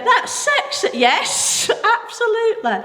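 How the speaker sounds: talking through high giggles; laughing. Real, not acted. Preacher still laughing about his dad's tough love. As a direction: keep laughing while getting the words out.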